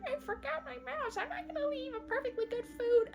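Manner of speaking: high, quick, fussy protest; hammed-up stubborn fussing; mock fussing. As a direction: fret quickly in a high put-on voice. Old cat refusing to leave.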